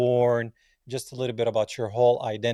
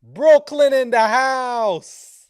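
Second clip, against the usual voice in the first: loud, high, stretched hype call; hype shout; 12 semitones higher, 9 dB louder, much slower than usual. Real, not acted. Host hyping up the guest's hometown, stretched shout.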